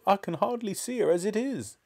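high-pitched distressed lament; wailing. A narrator's reading. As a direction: lament it in despair. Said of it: "Missus Cranky wailed".

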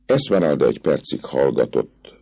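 ordinary delivery, nothing marked

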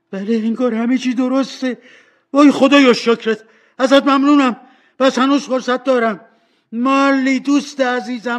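high, loud, overjoyed relief; restless excitement. Acted. Scrooge waking alive in his own room, wildly excited. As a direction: burst out thanking, overwhelmed to be alive. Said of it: "بیقرار و هیجان زده بود".

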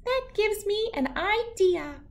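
high, thoughtful little voice; a tiny shrew hatching a plan. Acted. Shrew gets an idea, tiny high voice.

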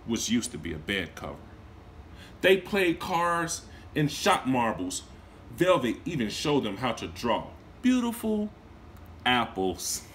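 gentle read-aloud storytelling; storybook narrator voice; story narration. A narrator's reading. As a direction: read it to a class of children. Teacher reads story aloud.

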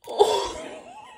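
very high, loud gasp breaking into laughter; astonished gasp. Real, not acted. Vlogger hitting the jackpot card, astonished. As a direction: gasp hard in delighted shock.

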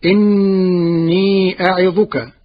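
slow, chanted إني أعظك; Quran recitation. Acted. Teacher reciting the verse to his class, slow and chanted. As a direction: intone the verse slowly like tajweed.

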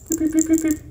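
very high toy squeak; high squeaky piep. Pretend. Squeaking toy noise for cat.

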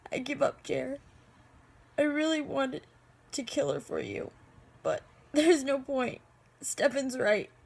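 halting, choked-up plea; helpless, weepy admission; tearful giving up. Acted. Acted tearful giving-up monologue.

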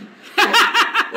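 ξέσπασμα σε δυνατό, ψηλό γέλιο; γέλα δυνατά και ψηλά. Real, not acted. Γέλιο για το πετρέλαιο. (δυνατό ψηλό γέλιο)

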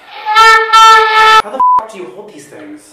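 loud, high, drawn out; exasperated outburst. Real, not acted. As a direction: stretch it out in disbelief. Struggling to hold the oboe.

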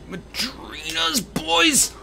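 high slow triumphant call; hype cheer. Real, not acted. Streamer cheers chat after the jump.

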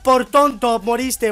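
loud taunt at the loser; mocking yell. Real, not acted. Gamer gloating over a beaten enemy, loud taunt. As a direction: shout it in his face, gloating.

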